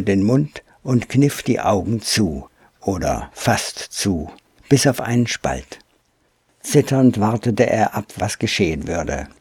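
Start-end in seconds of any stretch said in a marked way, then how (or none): none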